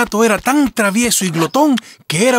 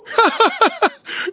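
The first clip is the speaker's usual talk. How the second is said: high, squealing laugh; a shrill burst of giggling disbelief; fox laughter; 14 semitones higher than usual. Acted. Fox laughing at the cat's grand title.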